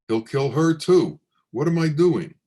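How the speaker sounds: a frightened father thinking aloud in a rush; panicked worry. Acted. Father panics about taking daughter.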